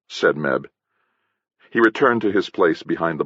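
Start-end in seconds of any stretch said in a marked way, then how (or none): none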